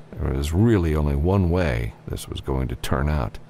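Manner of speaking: usual speaking voice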